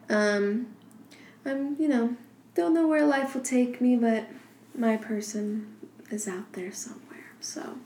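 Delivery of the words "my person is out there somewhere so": soft whisper. Real, not acted. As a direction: breathe it out quietly. Hushed aside about finding love.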